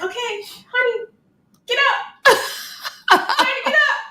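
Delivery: high sing-song coaxing; coaxing wife. Retold or imitated. Wife coaxing husband off the couch, high and sweet. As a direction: sweetly nudge a sleepy husband to get up. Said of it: "I'm like".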